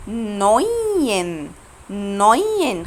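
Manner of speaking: نوين very slow, stretched "noyyy-en"; careful model pronunciation. Shown as a demonstration. Teacher gives first example to the class, stretched diphthong. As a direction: linger on the "oy" in نوين. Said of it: "أنا نطقت أوي إزاي؟".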